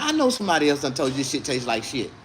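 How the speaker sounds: ordinary talk, nothing marked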